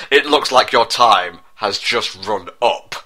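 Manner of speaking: ordinary delivery, nothing marked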